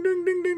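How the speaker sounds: very high, repeated "ding"; quick high-pitched dinging; imitated crossing bell. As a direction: mimic a railroad crossing bell. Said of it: "you hear the ding".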